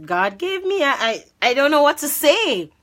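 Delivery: high, loud, halting stammer; flustered stuttering. Retold or imitated. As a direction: trip over the words in high-pitched panic. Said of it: "I started stuttering".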